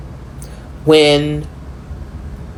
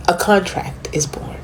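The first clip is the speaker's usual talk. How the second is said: near-whisper, softer than usual; hushed; 7 dB quieter than usual. Real, not acted. Speaker revealing how a divine contract begins, hushed. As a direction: drop to a hush for the reveal. Dramatic reveal in mystical monologue.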